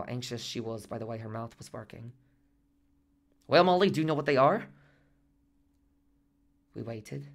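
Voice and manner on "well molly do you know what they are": loud, raised question to a patient; doctor's bright prompting. A narrator's reading. Doctor testing Molly's sight, prompting loudly. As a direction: ask her loudly and expectantly.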